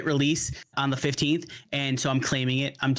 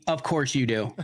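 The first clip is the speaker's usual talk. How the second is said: laughing. Real, not acted. Teasing a chat viewer.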